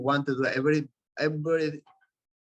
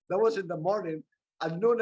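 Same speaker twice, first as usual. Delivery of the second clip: ordinary talk, nothing marked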